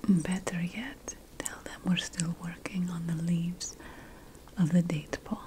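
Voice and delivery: ordinary talk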